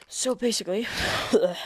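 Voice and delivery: breathless and winded; short of air mid-sentence; out of breath. Real, not acted. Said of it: "running out of breath".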